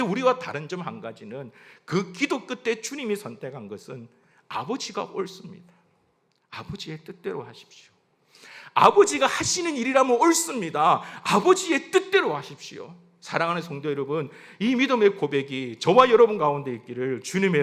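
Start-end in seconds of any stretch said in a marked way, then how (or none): 4.5-7.9 soft, yielding confession; submissive prayer. Acted. Jesus' submission, first quiet take. 8.7-12.9 high, loud confession of surrender; fervent declaration. Acted. Same submission, repeated with force.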